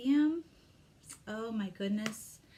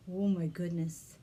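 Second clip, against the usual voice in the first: usual speaking voice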